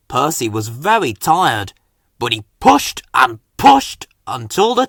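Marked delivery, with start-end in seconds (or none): none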